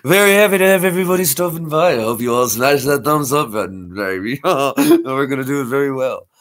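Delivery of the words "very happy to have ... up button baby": groove it like a cheeky sixties spy; Austin Powers. Retold or imitated. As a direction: Austin Powers greeting the viewers. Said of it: "a little bit of Austin Powers".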